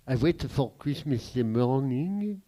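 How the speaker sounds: ordinary talk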